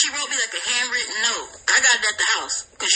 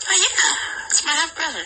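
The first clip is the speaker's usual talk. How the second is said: ordinary talk, nothing marked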